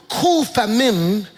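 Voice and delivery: kufamim drawn out as KOO-fav-mem, rising; slow careful pronunciation. Shown as a demonstration. Teaching the church, kufamim slowly as KOO-fav-mem. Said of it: "You'll pronounce it like".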